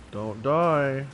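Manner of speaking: ordinary delivery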